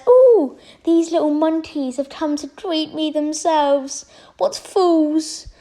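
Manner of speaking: high, slow, sneering villain voice; annoying voice. Acted. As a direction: sneer it out like a smug tyrant. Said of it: "I'm sorry for the annoying voice".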